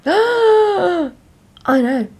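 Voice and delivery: high gasping fake shock; mock shocked gasp. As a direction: gasp like it's a scandal. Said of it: "shocking stuff".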